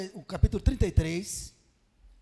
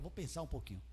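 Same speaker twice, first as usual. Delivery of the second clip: very soft, low musing; pondering aloud; 5 semitones lower, 14 dB quieter than usual. Acted. Preacher voicing God weighing the people's fate, hushed pondering. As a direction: drop right down, as if thinking to yourself.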